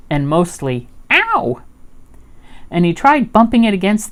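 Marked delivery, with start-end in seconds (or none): 1.1-1.6 high-pitched "ow"; sudden storybook cry of pain; pained yelp. A narrator's reading. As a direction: yelp as if you bumped your head. Pooh bumps his head.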